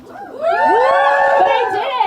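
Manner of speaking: high, loud, dragged-out declaration; mock triumphant shout. Real, not acted. Comic brags to the audience about skipping the crop top, loud and drawn out. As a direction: shout it like a small victory.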